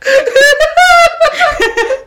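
a loud, high burst of laughter. Real, not acted. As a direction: crack up loudly. Friend cracks up.

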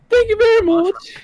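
put-on high girly thank-you; girl voice retake. Shown as a demonstration. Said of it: "let me try that again".

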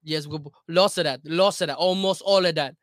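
emphatic, loud mock Italian accent; very Italian American accent. Shown as a demonstration. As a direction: pile it on in a hammy Italian accent. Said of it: "you're an Italian American, very Italian American".

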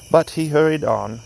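ordinary delivery, nothing marked

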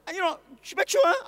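put-on a toddler afraid of the height; scared little boy. Son on the table begging dad, high fearful child voice.

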